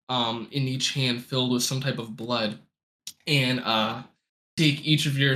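ordinary talk, nothing marked

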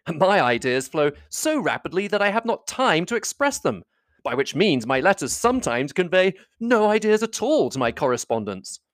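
lightly voiced airy gentleman, pitched high; good-humoured, high-pitched self-mockery; cheerful brother. A narrator's reading. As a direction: excuse yourself airily, starting high. Voices cheerful Bingley excusing himself.

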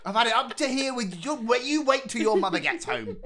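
high, loud, hammed-up scolding babysitter; exasperated babysitter. Podcaster mimicking an actor's delivery to a co-host, exasperated babysitter. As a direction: scold like a babysitter at the end of their tether. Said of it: "he's babysitting Carla Borrego and Jim Hawkins".

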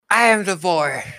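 a lightly voiced odd creature. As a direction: squeak it out as the bird creature. GM voices the Arakakra.

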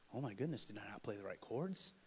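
soft, low, fretful self-doubt; small worried voice. Acted. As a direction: shrink into quiet second-guessing. Voicing worried wife's inner reaction.

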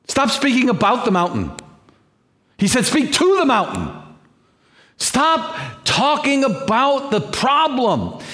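high, building preacher's cry; preaching rising. Real, not acted. Pastor urging the church, rising to a cry. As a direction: lift it up to a ringing cry. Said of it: "preacher builds to a cry".

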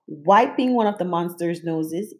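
plain reading voice

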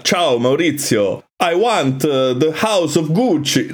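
mock a cheesy movie Italian accent; bad Italian accent. Mocking the film's actors to a co-host, bad Italian accent. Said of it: "They speak English with a bad Italian accent".

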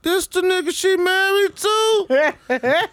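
imitating his own shock, stretched; mock disbelief. Retold shock at husband.

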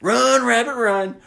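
hammed-up killer scream; screaming at camera. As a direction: yell it into the camera, building. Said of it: "him screaming at the camera".

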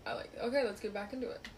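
usual speaking voice

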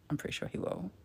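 hushed unvoiced aside; quiet knowing whisper. Real, not acted. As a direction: whisper it under your breath.

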